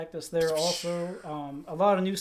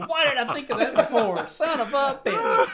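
cartoon cowboy voice; 6 semitones higher, 4 dB louder than usual. Yosemite Sam voice continues.

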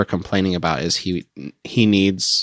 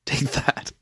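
whisper; 6 semitones higher, 5 dB quieter than usual. Real, not acted. Gloating after a right answer.

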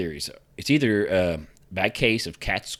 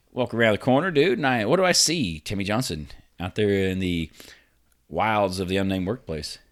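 usual speaking voice

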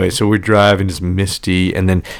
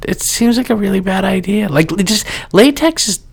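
high-pitched, grossed-out complaint; squeamish disgust; 11 semitones higher than usual. Real, not acted. Squeamish about latex and condoms.

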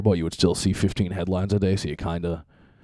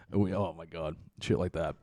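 ordinary talk, nothing marked

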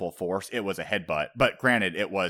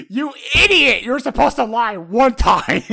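Usopp; 13 dB louder than usual. Retold or imitated. Usopp scolding Nami for failing to lie, exasperated comic shriek. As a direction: imitate a frantic comic scolding at full volume. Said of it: "amazingly funny scene with Usopp going".

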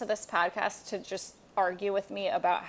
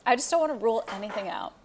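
usual speaking voice

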